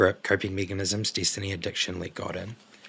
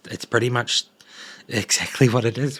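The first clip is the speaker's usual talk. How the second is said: usual speaking voice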